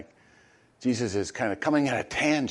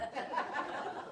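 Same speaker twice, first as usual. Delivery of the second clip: breathy laugh; 13 dB quieter than usual. Real, not acted. Laughs at own joke.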